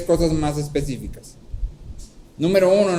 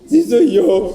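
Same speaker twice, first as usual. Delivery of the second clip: sister's reply; 6 semitones higher, 9 dB louder, much slower than usual. Retold or imitated. Sister answers from doorway.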